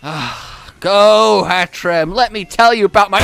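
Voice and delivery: hammed-up weary trainer starting a rant; complaining trainer. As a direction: moan your bad day at the Pokemon. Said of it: "you're like".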